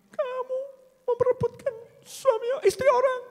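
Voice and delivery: very high crying shriek; mock shrieking wife. Wife shrieking at the rival in front of the village. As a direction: shriek it like a jealous wife making a scene.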